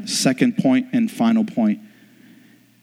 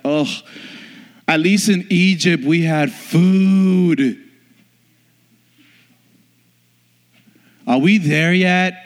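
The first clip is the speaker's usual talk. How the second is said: slow, drawn-out whine, higher; whiny complaining Israelites; 6 semitones higher, slower than usual. Retold or imitated. Preacher mimicking grumbling Israelites, slow whine. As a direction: whine like tired travellers. People of Israel whining on journey.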